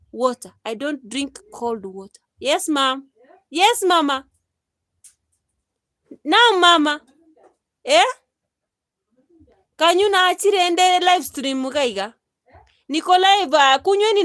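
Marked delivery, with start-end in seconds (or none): 2.4-8.1 loud call across the house. Real, not acted. Calling out to mother offscreen. 9.8-14.2 loud, high call across the house. Real, not acted. Calling to mother mid live stream.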